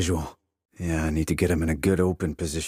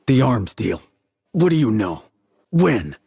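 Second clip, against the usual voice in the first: loud, higher, harsh demands; raised voice pressing for answers; angry interrogation; 5 semitones higher, 7 dB louder than usual. Acted. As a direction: bark questions at a prisoner under threat. Interrogating a captive with pliers.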